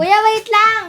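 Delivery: loud call back from inside; calling from far away. Acted. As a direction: shout it across the yard. Girl calls back from inside.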